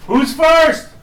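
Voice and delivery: loud high call to the next room. Real, not acted. Dad calling his kids in to record, loud high call.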